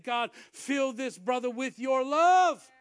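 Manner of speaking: loud, drawn-out plea to God; fervent prayer. Retold or imitated. Preacher praying over a crying brother, fervent prayer. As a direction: cry out in prayer, swelling to the end. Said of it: "I was praying in the Spirit".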